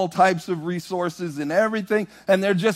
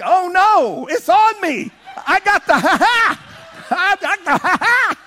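high, loud, laughing joy; overwhelmed by holy laughter; ecstatic laughing cry; 12 semitones higher, 5 dB louder than usual. Retold or imitated. Boy overjoyed the laugh is on him. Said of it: "I was like".

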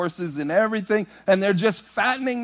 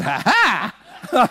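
laughing ha ha; 4 semitones higher than usual. Retold or imitated. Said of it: "I said".

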